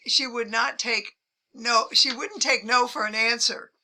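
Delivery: ordinary talk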